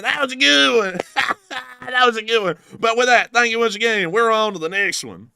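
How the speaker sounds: hammed-up Southern storytelling, tickled by his own joke. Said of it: "thank you for enjoying this accent".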